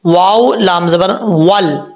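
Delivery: slow chanted वाव लाम ज़बर वल; slow recitation. Shown as a demonstration. Teacher spelling out वल, slow chant. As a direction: spell it out slowly. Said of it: "कैसे पढ़ा जाता है".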